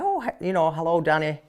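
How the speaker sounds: weak Irish accent imitation; attempted Irish accent. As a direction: try an Irish woman's greeting and barely land it. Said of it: "I can't do an Irish accent".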